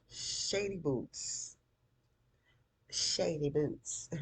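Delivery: slow, high, disapproving jab; exasperated, lingering dig; drawn-out name-calling. Real, not acted. Name-calling after scolding the characters.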